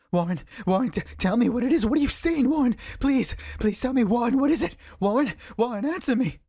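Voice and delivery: high, pleading, frightened questions; begging for an answer in panic; shuddering inquiry. A narrator's reading. Carter pleading down the telephone wire, high frightened begging. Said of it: "incoherent torrent of shuddering inquiry".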